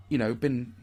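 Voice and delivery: ordinary delivery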